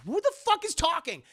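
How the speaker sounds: high, loud, baffled outburst; confused yell. Retold or imitated. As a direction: shout it like you're startled by a voice. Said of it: "'cause the guy's like".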